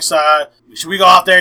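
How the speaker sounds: ordinary delivery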